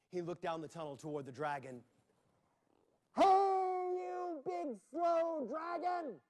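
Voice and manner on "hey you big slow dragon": high, drawn-out slow taunt. Acted. Jack calling down the tunnel to provoke the dragon, taunting. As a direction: stretch every word to mock the dragon.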